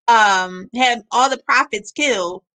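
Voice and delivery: ordinary talk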